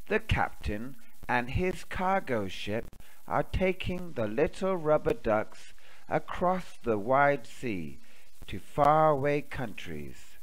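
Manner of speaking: plain reading voice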